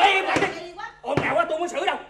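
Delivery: quát to, cao giọng dẹp ồn; hét cho cả xóm im; quát im lặng. Acted. Chú Tư quát đám đông ồn. (chú Tư quát đám đông)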